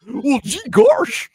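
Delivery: slow, loud, high goofy exclamation; goofy cartoon voice. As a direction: drag it out like a dopey cartoon dog. Cartoon character voice.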